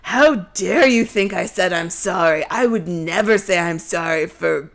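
low, affronted mock Beast; butthurt indignation. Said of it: "genuinely butthurt that this entire thing".

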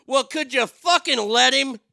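loud, high snap at a coworker; loud sarcastic interruption; mock exasperated retort. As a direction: cut him off with a loud jab. Retorting to the program director.

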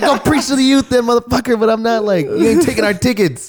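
high-pitched mock-yelled comeback; angry retort. Fan telling off supposed youth pastor, angry retort. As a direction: fire back in a retold shouting match. Retold yelling match with scalper.

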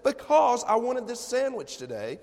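high, loud petulant protest, re-enacted; retold upset. Husband answering his wife about his sandwich, petulantly upset. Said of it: "Why are you getting so upset".

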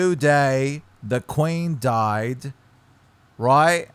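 slow English accent "Today the Queen died". Shown as a demonstration. English accent, showing his friend how it goes. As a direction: model it slowly in an English accent. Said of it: "I literally can't even say".

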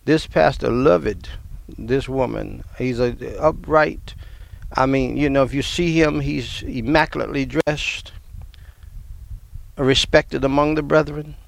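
ordinary talk, nothing marked

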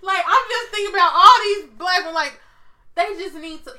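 loud and high, spirit rising; fervent outburst. Real, not acted. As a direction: preach it with rising fervour.

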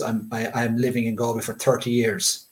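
ordinary talk, nothing marked

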